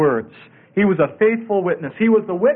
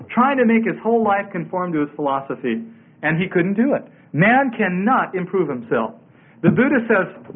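ordinary delivery

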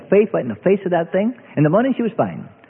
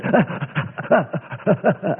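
relieved laugh; 4 semitones higher, 3 dB quieter than usual. Real, not acted. Relief as son breathes again.

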